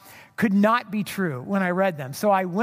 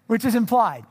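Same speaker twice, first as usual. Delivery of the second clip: usual speaking voice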